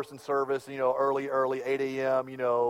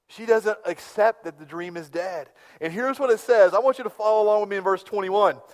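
ordinary talk

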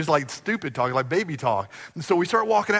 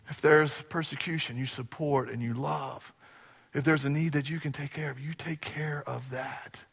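softer, hushed appeal; soft intimate preaching; 2 semitones lower, 6 dB quieter than usual. Acted. Pastor quietly urging the congregation to care. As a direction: draw them in quietly.

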